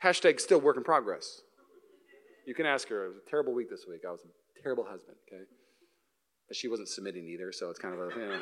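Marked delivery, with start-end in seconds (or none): 2.5-8.4 sheepish aside. Real, not acted. Joking confession about wife.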